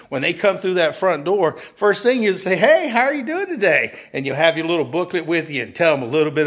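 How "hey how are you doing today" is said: high, friendly demo greeting; cheery sales greeting. Acted. Salesperson greets customer coming through front door, cheery and high. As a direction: greet the customer in a lifted, welcoming voice.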